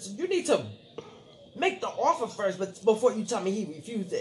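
high, loud scolding of the lawyer; judge scolding. Retold or imitated. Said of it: "She said".

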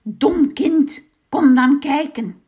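loud, high scornful witch. A narrator's reading. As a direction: sneer at the stupid child. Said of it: "zei de heks". (minachtende heks, luid)

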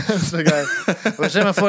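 amused, laughing through words. Real, not acted. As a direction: giggle while saying it. Laughs at own joke.

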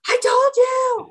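very high, loud imitated scream. Imitating friend on the phone after the news, screaming. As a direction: imitate a friend's triumphant I-told-you-so shriek. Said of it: "And she's screaming".

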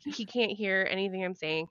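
normal talking voice

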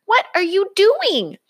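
high, loud dismayed cub; upset little cub pleading; alarmed cry. Acted. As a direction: cry out as the snacks go. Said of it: "cried Sister Bear".

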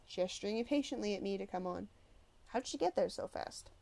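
usual speaking voice

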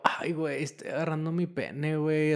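hushed, embarrassed reply; sheepish quiet. Retold or imitated. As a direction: drop the voice, a bit caught out. Sheepish reply in acted bathroom exchange.

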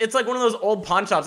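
ordinary talk

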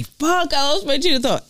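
high-pitched exasperated outburst; mock-frustrated whine. Real, not acted. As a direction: voice jumps up in frustration. Frustrated at losing the thread.

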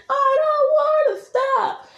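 very high, stretched-out refusal; escalated mock yell. Shown as a demonstration. Creator plays the partner refusing, drawn-out high mock yell. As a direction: yell it back at peak pitch, slowly. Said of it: "It's that kind of yelling".

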